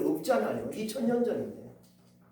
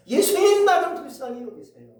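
shouted high plea for mercy; loud desperate cry; 6 semitones higher, 8 dB louder than usual. Acted. Blind man shouting louder to Jesus, loud desperate cry. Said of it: "더 크게 소리치는 거예요".

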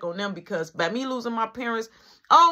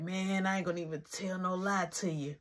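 much softer, owning up; hushed confession; 3 semitones lower, 11 dB quieter than usual. Retold or imitated. Gerard admitting where he is, soft confession. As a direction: quietly come clean to a friend.

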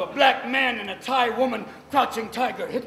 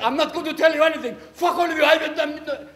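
higher, louder, fast hammed-up defiance; mock defiant yell; 8 semitones higher, 7 dB louder than usual. Captive defying his interrogators, mock furious yell. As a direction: spit it out like a stubborn prisoner.

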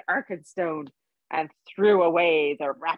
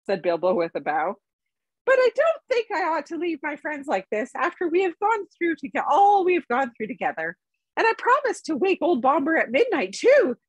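higher, lively hobbit voice pleading his case; a polite hobbit bargaining with the Elvenking; Bilbo the hobbit; 9 semitones higher than usual. A narrator's reading. Bilbo makes his offer to the king, higher and brisk.

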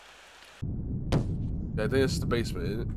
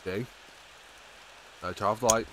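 usual speaking voice

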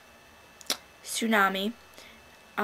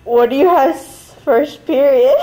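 laughing question; 4 semitones higher, 15 dB louder than usual. Real, not acted. Asks friend while laughing, loud.

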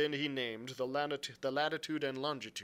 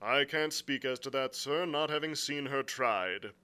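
lightly voiced frank captain; blunt sea captain; 4 semitones higher, 6 dB louder than usual. Captain answering the angry squire, bluntly. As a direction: answer with a touch of stiff formality. Captain's dialogue beside narration.

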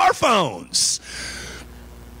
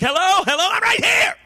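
screaming into the phone; 12 semitones higher than usual. Retold or imitated. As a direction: shout to be heard on a dropped call. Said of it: "You're just screaming at the top of your lungs".

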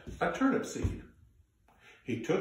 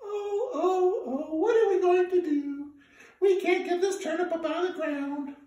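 put-on much higher little girl voice, fretting; young granddaughter. Granddaughter worrying about the stuck turnip. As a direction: fret in a high young voice. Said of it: "granddaughter said".

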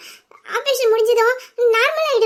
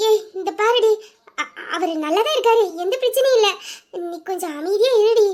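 normal talking voice

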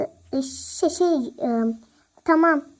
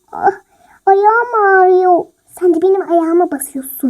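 loud complaint; 10 dB louder than usual. Acted. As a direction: yelp that your foot hurts. Foot stepped on, complaining. (ayağına basılmış, acıyla yüksek sesle sızlanma)

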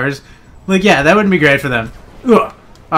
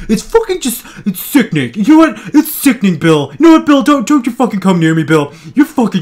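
mock angry rant; 6 semitones higher, 3 dB louder than usual. Ranting at enemy Bill.

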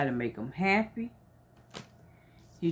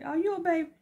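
much higher, playful question; high teasing question; 11 semitones higher, much faster than usual. Acted. Teacher acting a teasing question to a child. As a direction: ask it like you're teasing a little one.

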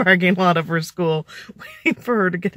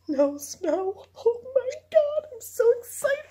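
high, far, squealing fake joy; sarcastic over-the-top delight; mock excited squeal; slower than usual. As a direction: squeal like it's the best news ever. Sarcastic squeal about no snow.

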